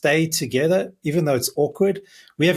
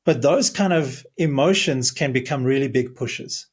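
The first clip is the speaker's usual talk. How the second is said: ordinary talk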